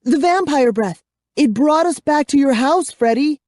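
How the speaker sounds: high, eager tumbling words; girl telling her friend excitedly. A narrator's reading. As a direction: blurt out the discovery. Girl excitedly explaining escape.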